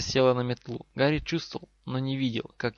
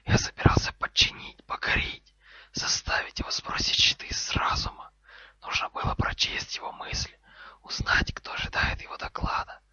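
scornful, seething whisper; raging snake hiss. Acted. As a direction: hiss a furious tirade without voice. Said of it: "В шипении змеи было больше ярости".

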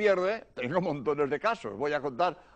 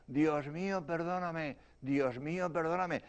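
much softer, acted victim's prayer; faint answering voice; 8 dB quieter than usual. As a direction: answer weakly, as if from the wreck. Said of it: "oí una voz que decía". (voz débil que responde, muy bajito)

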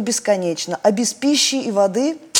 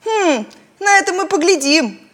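determined; 9 semitones higher, 3 dB louder than usual. A narrator's reading. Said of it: "решительно заявил Маляк".

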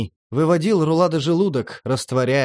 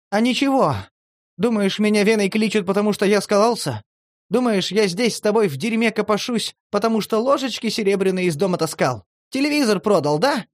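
higher, bitter, building challenge; bitter outburst; 7 semitones higher than usual. A narrator's reading. Vena lashes out at Demid, higher and bitter. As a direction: hurl the questions back at him.

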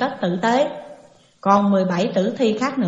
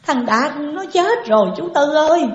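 higher-pitched acted wail; tearful lament; 6 semitones higher, 4 dB louder than usual. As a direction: call out the death in lightly performed grief. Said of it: "nước mắt nước mũi chàm hoàm".

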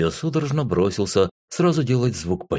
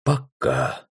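тяжёлый вздох; 7 semitones lower than usual. A narrator's reading. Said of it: "тяжело вздохнул я".

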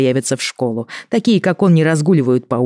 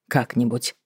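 soft, doubtful repeat of Как-нибудь; skeptical echo; 3 semitones lower, 8 dB quieter than usual. Retold or imitated. Katya echoes skeptically.

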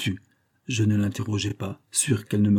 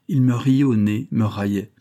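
usual speaking voice

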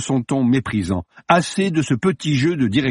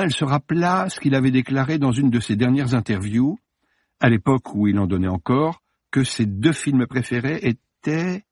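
usual speaking voice

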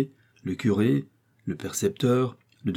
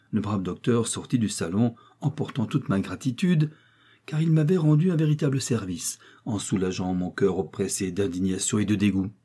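plain reading voice

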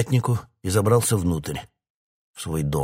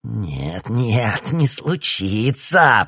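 crazed colonel; 4 semitones higher, 6 dB louder, much slower than usual. A narrator's reading. Mad colonel before laughter.

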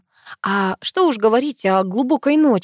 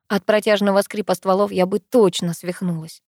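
ordinary delivery, nothing marked

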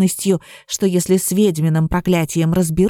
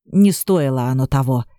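lower, sighing with vexation; annoyed sigh; 8 semitones lower than usual. A narrator's reading. Горыныч watching the witch leave, a lower vexed sigh. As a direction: sigh it out low, fed up. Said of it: "с досадой вздохнул Горыныч".

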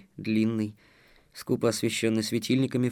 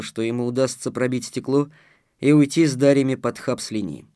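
ordinary delivery

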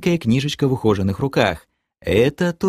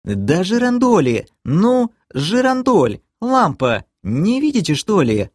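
put-on higher, indignant girl's voice; 10 semitones higher than usual. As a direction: huff at them for not seeing it. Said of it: "вознегодовала Кэлен".

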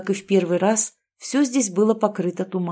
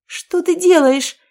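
high, loud, slow terrified wail; 10 semitones higher, 7 dB louder, slower than usual. A narrator's reading. Girl wails at Aino as hot stone nears her face. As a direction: cry out in terror as the heat nears. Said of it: "завопила я".

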